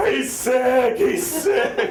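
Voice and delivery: very high, loud, wailing repeat; hammed-up sobbing wail. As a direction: wail it through tears. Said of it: "And I'm like".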